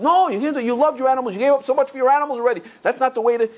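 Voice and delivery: urgent pleading. Retold or imitated. Rabbi pleads with suicidal man.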